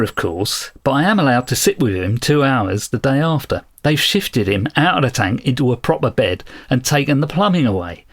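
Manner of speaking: Cockney accent. Shown as a demonstration. Co-host demonstrating the voice he heard while reading, in Cockney. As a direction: read it aloud as a Cockney narrator, dropping aitches. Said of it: "I started hearing a Cockney voice reading this".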